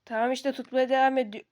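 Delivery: ordinary delivery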